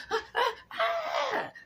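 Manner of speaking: very high slow laughing cries; laughing mock yelps. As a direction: cackle through mock alarm. Mock panic continued.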